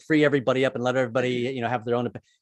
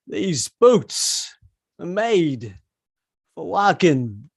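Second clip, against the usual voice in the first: a hint of Walken's halting delivery; choppy Walken-style sing-speak; Christopher Walken; 2 semitones higher, much slower than usual. Comic does Walken singing, halting sing-speak. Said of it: "Christopher Walken singing".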